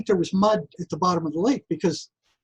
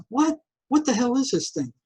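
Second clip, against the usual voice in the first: startled disbelief; 6 semitones higher than usual. Retold or imitated. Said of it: "And I'm gonna".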